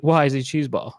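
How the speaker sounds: ordinary talk, nothing marked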